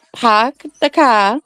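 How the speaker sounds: slow "pahk the cah", vowels dragged; Boston accent. Shown as a demonstration. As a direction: drag the vowels, no R's. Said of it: "you're not even saying the T".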